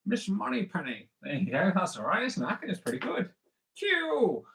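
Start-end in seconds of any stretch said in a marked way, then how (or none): 0.0-1.1 high, swooping celebrity impression; hammed-up Bond actor greeting colleagues; Sean Connery. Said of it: "That's my impression of Sean Connery". 3.8-4.4 high, drawn-out falling exclamation; hammed-up Bond actor calling out; playful, swooping film-star impression; Sean Connery. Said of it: "That's my impression of Sean Connery".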